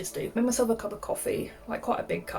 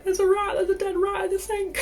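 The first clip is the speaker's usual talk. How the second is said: high, loud panicked shout; panicked cry; 11 semitones higher, 5 dB louder than usual. Retold or imitated. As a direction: yell it out in a panic about the rat.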